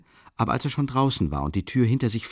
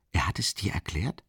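stunned whisper; whispered disbelief; 6 semitones lower, 8 dB quieter than usual. Acted. Raskolnikov hears the investigator explained it, whispering. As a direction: breathe it out, unable to believe.

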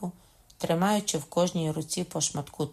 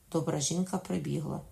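usual speaking voice

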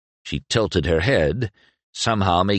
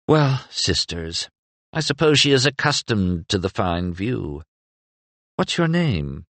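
Mira voice; 3 semitones higher than usual. A narrator's reading. Mira flirting.

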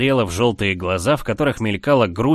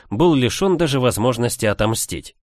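usual speaking voice

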